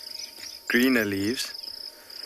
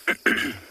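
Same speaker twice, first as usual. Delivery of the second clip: sudden choking cough; just swallowed a bug. Real, not acted. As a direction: cough out an inhaled fly. Just inhaled a fly.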